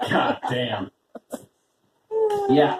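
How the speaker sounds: usual speaking voice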